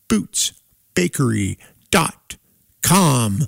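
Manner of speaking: upbeat radio announcer read; sponsor read. Acted. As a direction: sell the sponsor like a DJ.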